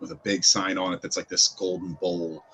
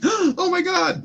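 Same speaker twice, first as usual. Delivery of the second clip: panicked exclaim; 4 dB louder, much slower than usual. Retold or imitated. Retold panicked reaction.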